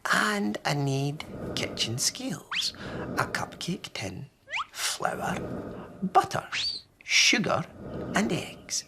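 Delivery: high granny listing things; a busy old lady reciting a list; wee Granny. Acted. Granny lists ingredients.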